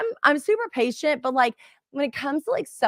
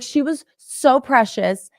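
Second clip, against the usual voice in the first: ordinary delivery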